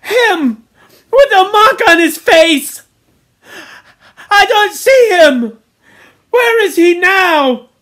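high, loud cries between gulps of breath; Piggy gasping, panicked. A narrator's reading. Piggy searches for the missing child, gasping panic. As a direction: cry out in rising alarm. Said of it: "he was gasping for breath".

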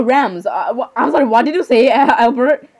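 usual speaking voice

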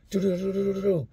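high rapid do-do-do thuds; imitated blows. Narrator mimicking the beating in the cell, high rapid thuds.

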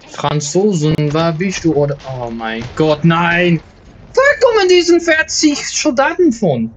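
mock Dutch-German gamer talk, with a jump up in pitch at the soldiers; mixed Dutch-German. Gamer calling the French in mock Dutch-German, then alarmed. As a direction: play a fake Dutch-German soldier, alarmed at the crowd of enemies. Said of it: "Dutch and German combined".

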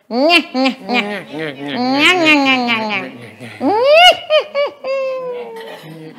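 bright, squeezed twang rising into a wail; twang demo. Shown as a demonstration. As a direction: squeeze the sound like a crying baby, then let it climb high. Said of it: "So this is twang".

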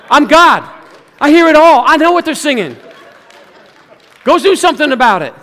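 loud commanding divine voice; booming God voice. Pretend. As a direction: boom it out as God. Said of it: "God said".

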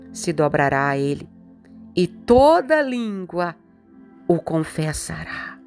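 normal talking voice

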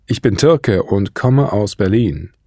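usual speaking voice

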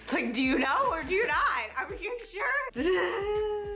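high, laughing mock question; cracking up while teasing, very slow on the last words. Real, not acted.

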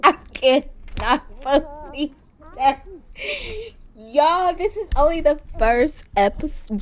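loud, worked-up disbelief; yelling. Real, not acted. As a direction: yell it out, scandalized. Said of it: "I'm sorry if I'm yelling".

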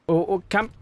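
normal talking voice

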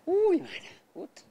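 high, loud "Uy" of surprise; startled, abashed exclamation; embarrassed yelp. Real, not acted. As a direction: caught off guard and squealing. Embarrassed at the question.

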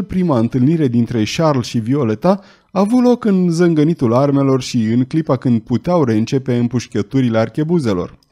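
plain reading voice

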